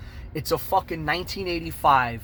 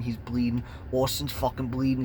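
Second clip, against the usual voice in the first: ordinary talk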